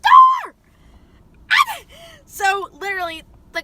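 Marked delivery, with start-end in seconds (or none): 1.4-2.2 high shrieking burst of laughter. Real, not acted. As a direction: laugh out loud, almost a scream. Bursts out at cop arriving.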